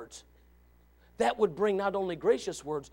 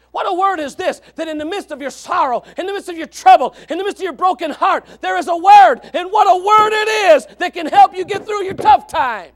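loud and higher, building to a peak; climactic preaching; 10 semitones higher, 14 dB louder than usual. Real, not acted. Preacher's closing crescendo to the hurting congregation, very loud.

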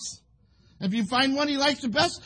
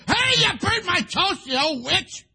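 loud, high, drawn-out husband's bellow; hammed-up outraged shouting; mock angry yell; 9 semitones higher, 7 dB louder, slower than usual. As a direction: holler an exaggerated complaint at the wife. Imagined husband yelling over burnt toast.

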